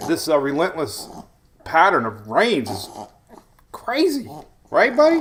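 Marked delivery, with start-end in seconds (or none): 3.7-5.2 high-pitched playful coo to a little one. Real, not acted. Talking playfully to his baby.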